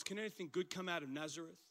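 quick, soft, dismissive muttering; quiet cynical scoff at Nazareth; cynical response. Retold or imitated. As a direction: toss it off with a sneer. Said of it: "this cynical response".

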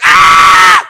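sudden loud breathy scream; frightened shout on waking; startled scream. Retold or imitated. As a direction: shriek as if woken by a face. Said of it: "you would have the same reaction".

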